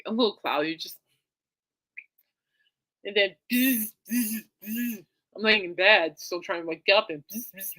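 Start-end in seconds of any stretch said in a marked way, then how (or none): 3.0-7.8 buzzing lips like a vibrating phone. Retold or imitated. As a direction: mimic the phone going off. Imitating phone buzzing in bed.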